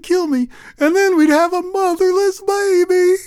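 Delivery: high, tearful pleading; pleading woman in tears. Acted. Crying family pleading.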